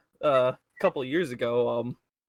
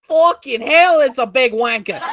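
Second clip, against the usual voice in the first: high, loud bootleg croc-hunter; hammed-up Aussie wildlife host, yelled high; Steve Irwin; 8 semitones higher, 10 dB louder than usual. Contestant riffing on the crocodile, loud bootleg Steve Irwin. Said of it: "bootleg Steve Irwin".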